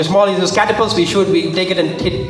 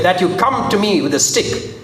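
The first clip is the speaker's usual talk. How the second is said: ordinary talk, nothing marked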